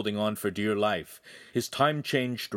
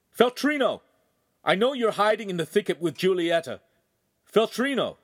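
high, loud call across the woods; shouting; 11 semitones higher, 5 dB louder than usual. A narrator's reading. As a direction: call out to someone hidden far off. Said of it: "took a deep breath and shouted".

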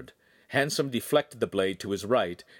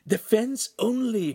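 high, drawn-out warning cry; coach's urgent high-pitched cry; 12 semitones higher, 4 dB louder, slower than usual. A narrator's reading. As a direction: call out a slow, high command to the fighter. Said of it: "Pan cried".

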